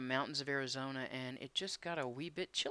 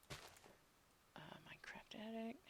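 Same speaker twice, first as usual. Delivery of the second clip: whispering; 7 semitones higher, 16 dB quieter, much slower than usual. Real, not acted. Reading a name to himself.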